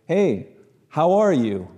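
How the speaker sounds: cheery, drawn-out greeting; Midwest greeting. Retold or imitated. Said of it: "this is Midwest".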